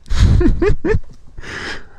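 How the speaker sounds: short high breathy laugh; a quick laugh at his own rant. Real, not acted. Laughs at himself after ranting about the coworker.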